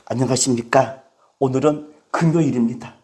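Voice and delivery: low, subdued YouTuber greeting; low-key mimicked video intro; YouTuber's greeting. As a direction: imitate a host opening in a low, soft voice. Imitates a YouTuber's daily greeting.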